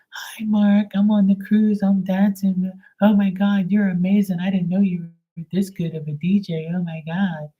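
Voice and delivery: put-on high, giddy, breathless girly voice; drunk girl impression. Drunk girl gushing at the DJ on the cruise, high giddy voice. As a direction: gush like a tipsy teenage fan.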